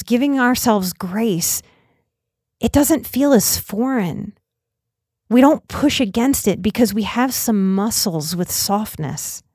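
ordinary talk, nothing marked

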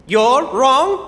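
can't believe he's being corrected; indignant disbelief. Acted. Scholar bluntly contradicted, indignant.

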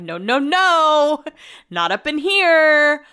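drawn-out high, loud protest; loud and slow, shutting it down; loud refusal. Retold or imitated. Dreading being thought pregnant.

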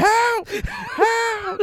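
high, loud mock yell; mock cry for help. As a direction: yell it like a panicked kid running off. Mock kid crying for help.

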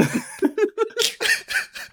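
very high rising laugh; squealing laugh. Real, not acted.

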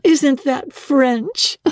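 high, slow, amused wife's voice; drawn out with delighted amusement; wife, amused, teasing her husband. A narrator's reading. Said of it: "appreciative laugh".

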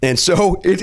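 ordinary delivery

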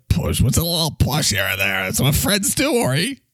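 usual speaking voice

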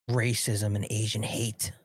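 normal talking voice

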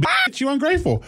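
high sassy line cracked by laughter; laughing through the line. Retold or imitated. Dad repeating daughter's punchline, high and laughing. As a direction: say it while laughing, mock sass. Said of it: "So I was like".

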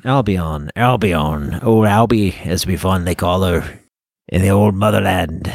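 put-on lapsing into a pirate accent; a failed British accent that drifts pirate; mock British accent. Host hams up Britain, slipping into a pirate accent. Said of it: "can't do my British accent anymore".

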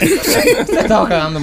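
slow, laughing through the words; can barely hold the laugh in. Real, not acted. Cracking up at the joke. (hablando entre risas)

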